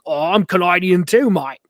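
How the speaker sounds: broad mock Australian greeting; Australian accent. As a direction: put on a hearty Australian accent. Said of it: "this guy came in from Australia".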